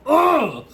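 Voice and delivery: loud grossed-out ugh; disgusted groan. Real, not acted. Disgusted by the song.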